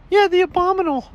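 very high cartoon clerk voice; high-pitched agreeing clerk; shop clerk character.

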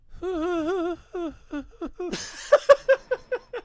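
high, giggly sinister cackle; a half-hearted creepy clown giggle; evil clown laugh. Pretend. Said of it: "your shitty clown laugh that you just did".

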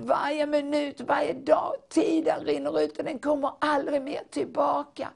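high, urgent pleading proclamation; fervent prophecy. Acted. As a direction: cry out God's longing to his people.

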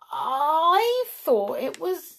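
high, very slow disbelief; astonished. A narrator's reading. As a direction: stretch the words in amazement. Patter astonished to see Perry.

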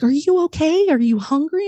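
high, fussing mother voice; concerned mom. Retold or imitated. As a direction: imitate a mom checking on her kid. Voicing her unexpectedly caring mom.

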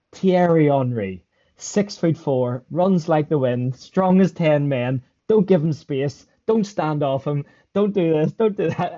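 mock-grave warning, each item hammered out slowly; imitated manager. Manager warning players about Henry, mock-emphatic. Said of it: "our manager, reading out".

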